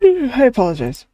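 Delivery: yawning through the words. Real, not acted. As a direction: say it mid-yawn. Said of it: "I keep yawning".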